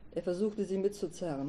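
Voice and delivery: plain reading voice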